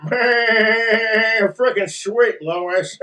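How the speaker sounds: very slow cartoon dad with silly chuckle; chuckling cartoon dad catchphrase; Peter Griffin. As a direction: draw it out as a delighted oafish dad. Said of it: "do a Peter Griffin voice".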